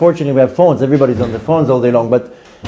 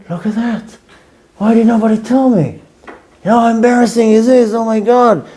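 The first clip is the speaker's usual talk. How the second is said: higher-pitched embarrassed complaint; mortified disbelief; 7 semitones higher than usual. Retold or imitated. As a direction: replay being appalled at your own video. Replaying his mortified reaction to video.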